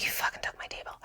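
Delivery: very soft curse, whispered under the breath; hushed, unvoiced frustration. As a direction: curse it quietly so nobody hears. Staring down the lady who took her table.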